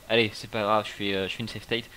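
ordinary talk, nothing marked

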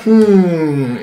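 usual speaking voice

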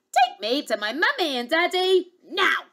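high, insistent little princess; bossy demand. Acted. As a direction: order it, ignoring all manners. Princess repeats her bossy order.

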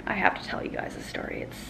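hushed, secretive whisper to the camera. Real, not acted. Vlogger whispering to her viewers before the story, hushed whisper. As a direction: lean in and breathe it like a secret.

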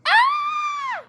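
very high shrieking yell; attack scream. Acted. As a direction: shriek as you pounce. Monster doll attacks.

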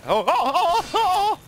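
very high laughing squeals; excited squeal. Real, not acted.

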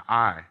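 "I" said loud and emphatic; a self-important, punched "I"; stress on I. Shown as a demonstration. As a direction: lean hard on the word "I". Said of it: "that's arrogance".

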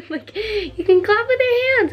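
high, slow giggly voice; giggling at your own silly trick; laughing. Real, not acted. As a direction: laugh through the words. Giggling at own one-hand clap.

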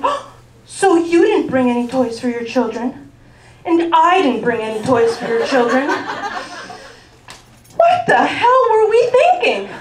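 sarcastic, building to a laughing shout; mock indignant rant ending in a shout; mock outrage. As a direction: needle her, then blow up. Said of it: "I'm like".

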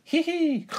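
very high, loud hee-hee; squeaky acted titter; child's giggle. A narrator's reading. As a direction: giggle like a delighted tiny piglet. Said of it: "giggles George".

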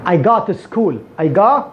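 "go" as open "gah", "to" reduced to "ta"; open vowel. Shown as a demonstration. Teacher modelling American and British speech, open vowel. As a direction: open the mouth on "go". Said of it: "والفم، والفم مفتوح".

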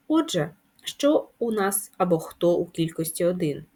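ordinary delivery

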